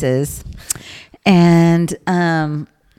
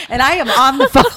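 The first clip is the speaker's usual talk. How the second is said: ordinary talk, nothing marked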